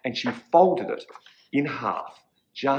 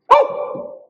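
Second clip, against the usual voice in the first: loud, sharp gasped "oh"; shocked gasp; 8 dB louder than usual. A narrator's reading. Witch realizes she forgot the door, loud gasp. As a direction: suck in air in sudden shock.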